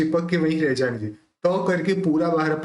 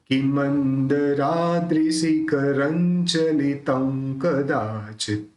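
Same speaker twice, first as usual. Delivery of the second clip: slow, drawn-out recitation of the whole verse; slower than usual. Shown as a demonstration. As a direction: stretch every syllable for students to follow. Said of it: "अब देखिए".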